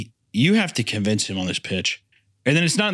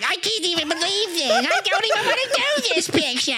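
whiny scared director. Retold or imitated. Continuing the scared Spielberg bit.

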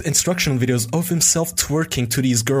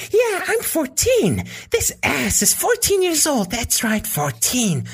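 high, slow, sleazy falsetto; oily cartoon-villain girl voice; shady cartoon dealer; 13 semitones higher, slower than usual. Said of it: "fourteen-year-old girl impression sounds like a shady dealer in a children's cartoon".

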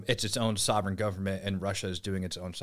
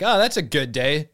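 bright, loud, higher chirp of oblivious cheer; cheery retold remark; 7 semitones higher, 10 dB louder than usual. Retelling meeting his new private, loud and cheery. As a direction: pitch it up like it's great news. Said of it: "I looked at it and I was like".

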